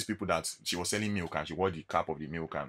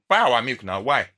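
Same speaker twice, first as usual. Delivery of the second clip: louder imitation of a nagging saleswoman; mock pushy seller; 4 semitones higher, 10 dB louder than usual. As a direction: push the line like an insistent seller. Seller insists, following him.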